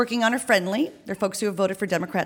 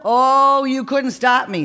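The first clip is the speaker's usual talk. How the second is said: raised, higher call from above; yelling down; 5 semitones higher, 6 dB louder, slower than usual. Acted. Woman upstairs to canvassers, yelling down. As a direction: yell it down from a window. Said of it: "she yells down".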